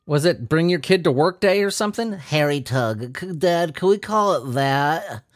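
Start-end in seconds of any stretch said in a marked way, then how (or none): 2.1-3.2 put-on kid voice. Kid voice at bring-your-kid day. 3.2-5.2 lightly voiced little kid, slow; a hint of a small boy asking his dad. A lightly voiced kid asking Dad at work.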